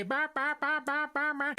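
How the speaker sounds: high, yappy bah-bah babble; mocking nonstop chatter noise; mock yapping. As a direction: imitate an endless yapping complaint. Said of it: "beaking away".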